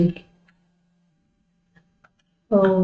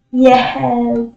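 excited cheer; 6 semitones higher than usual. Real, not acted. Cheering after winning a round.

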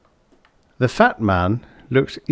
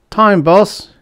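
higher, louder cut-in; sudden interruption; 12 semitones higher, 8 dB louder than usual. A narrator's reading. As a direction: blurt it out to stop the boss. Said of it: "interrupted the rodentish little Giles Jackson suddenly".

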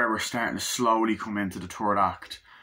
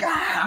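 very high, loud short shriek; hammed-up crowd scream; mock scream; 4 dB louder than usual. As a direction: shriek like a fleeing clubgoer. Clubgoers screaming at the gunfire.

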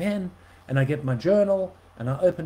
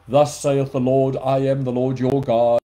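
King James God voice; 2 semitones lower, 9 dB louder than usual. Shown as a demonstration. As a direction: thunder it like an old-fashioned oracle. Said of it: "speak to you like".

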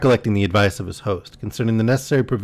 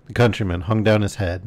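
usual speaking voice

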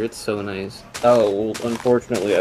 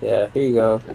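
ordinary talk

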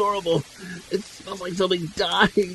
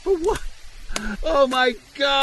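much higher, rising panic; frightened cry; 6 semitones higher than usual. Acted. Scared friend crying out beside the armed hunter. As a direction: voice jumping up in fear.

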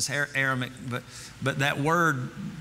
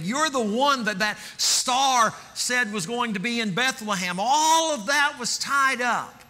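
a pastor swept up proclaiming who Jesus is; preaching at full force; 7 semitones higher than usual. Acted. Preaching builds to climax.

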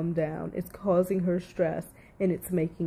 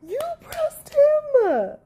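outraged retort; 11 semitones higher, 5 dB louder than usual. Real, not acted. Commentator talking back to the woman on the recording, real outrage. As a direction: climb higher and louder into stretched, furious accusation.

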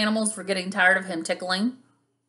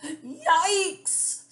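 high-pitched startled squeak; acted storybook yelp, pitched way up; 10 semitones higher than usual. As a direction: squeak it like a frightened little octopus. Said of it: "squeaked Octopus".

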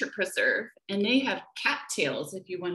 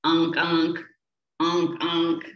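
stuffy-nosed unk; 3 semitones lower than usual. A narrator's reading. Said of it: "sound like they have stuffy noses".

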